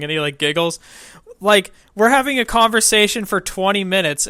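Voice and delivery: normal talking voice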